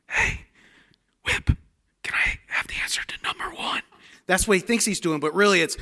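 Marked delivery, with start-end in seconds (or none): 0.1-3.8 hushed unvoiced whisper, a bit quick; intended whisper. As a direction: whisper it the way he thinks he sounds. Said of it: "what in his mind is saying".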